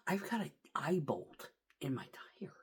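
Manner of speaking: hushed whisper; whispered reveal. Real, not acted. Trucker revealing the bolt, hushed whisper. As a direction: share the shocking find under your breath. Aside while telling.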